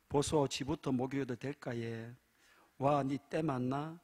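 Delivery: a hint of Gyeongsang dialect banter. Preacher voicing a Gyeongsang couple, in Gyeongsang dialect. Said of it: "경상도 여자".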